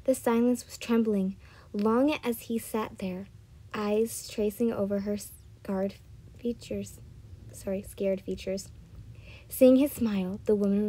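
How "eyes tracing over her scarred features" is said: usual speaking voice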